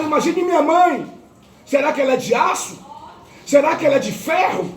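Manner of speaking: high, pleading preacher's cry; testimony preached at full force; impassioned preaching. Acted. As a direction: preach it out to the congregation.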